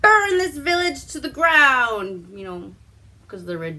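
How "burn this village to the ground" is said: louder, higher barked command; commanding order. Retold or imitated. Prospero orders his men to burn the village, loud command. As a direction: order the village burned, full force. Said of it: "Prospero is like".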